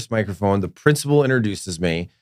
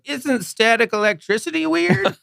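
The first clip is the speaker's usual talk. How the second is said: Eric Page; 11 semitones higher than usual. Retold or imitated. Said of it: "Eric had to go out there and be like".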